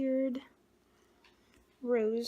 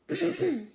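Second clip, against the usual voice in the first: clears a rough throat; a quick rasping throat clear; 7 semitones higher, 6 dB louder than usual. Real, not acted. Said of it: "Sorry about my voice".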